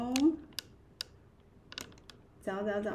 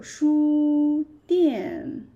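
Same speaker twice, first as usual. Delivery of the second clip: 书店 stretched very slowly and louder, 书 high level, 店 falling; slow demo; 5 semitones higher, 7 dB louder than usual. Shown as a demonstration. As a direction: spell out 书店 slowly for beginners. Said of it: "means store".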